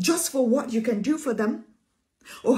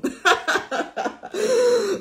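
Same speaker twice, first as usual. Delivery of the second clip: real laugh; 8 semitones higher than usual. Real, not acted. Preacher laughs at own point.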